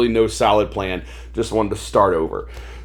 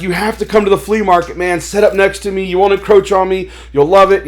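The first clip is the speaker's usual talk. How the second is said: eager urging; 8 semitones higher, 5 dB louder than usual. Retold or imitated. Flea market seller urging a new friend to join him, eager. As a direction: imitate an excited pal's pitch, high-pitched.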